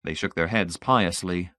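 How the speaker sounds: ordinary delivery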